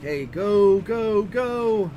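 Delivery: high, loud panicked urging; frantic go go go; scared horror game streamer. Real, not acted. Panicked while the red chases.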